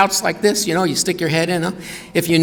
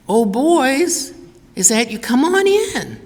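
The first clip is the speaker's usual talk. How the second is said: put-on much higher, slow, sugary "Oh, boys, is that you? Come on in."; overly sweet father; 10 semitones higher, slower than usual. Father luring his sons inside, hammed-up sugary high voice. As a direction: ham up a syrupy-sweet dad welcoming his sons. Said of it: "That can't be our father".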